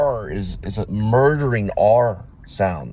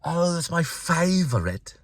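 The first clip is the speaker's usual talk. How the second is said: high, slow, soft London English impression; London accent; 7 semitones higher, 4 dB quieter, slower than usual. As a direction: imitate her London "favourite", drawn out high. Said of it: "the way she says".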